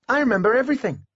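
put-on high child's voice, proud. A narrator's reading. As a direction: boast like a clever boy. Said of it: "replied Miles proudly".

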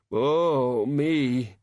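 groaning. A narrator's reading. Hungover boy groaning.

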